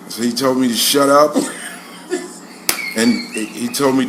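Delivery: low, halting confession to teacher; chastened schoolboy. Retold or imitated. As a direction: recite dad's orders haltingly, pitch dropped. Chastened boy reporting to teacher.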